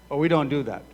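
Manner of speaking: ordinary talk, nothing marked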